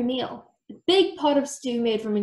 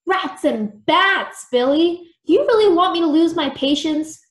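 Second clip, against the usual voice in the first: loud, high-pitched impatient outburst; cross and emphatic; snapping; 6 semitones higher, 6 dB louder than usual. A narrator's reading. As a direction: snap at someone testing your patience. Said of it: "Creepella snapped".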